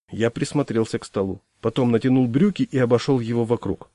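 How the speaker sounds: plain reading voice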